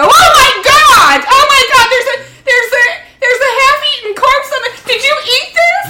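very high, loud mock horror; horrified shriek. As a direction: shriek in hammed-up panic. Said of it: "I just go".

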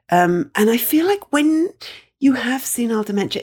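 usual speaking voice

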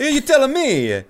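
high, loud laughing Italian waiter; laughing through a big Italian accent. Acted. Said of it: "both boys speak in thick Italian accents".

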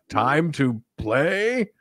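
very slow, ominous villain drawl; Pinhead voice. Pretend. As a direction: stretch it out like a horror villain. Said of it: "they're like".

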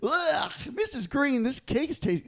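put-on high-pitched disgusted kid; complaining kid. Kid complaining to friend's mother about cake, disgusted. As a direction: whine up high at the bad cake. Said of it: "he's like".

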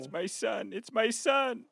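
high, hushed, overcome voice; tearful wonder. Pretend. Father sees his son's face on the baby, softly overcome. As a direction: marvel softly on the edge of tears.

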